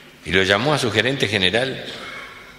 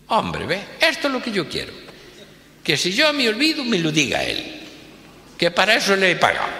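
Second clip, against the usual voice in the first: higher-pitched, quicker at the end; pleased boss; 10 semitones higher than usual. Acted. Rich boss approves the car's warning, raised pitch. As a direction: boss delighted the car scolds him.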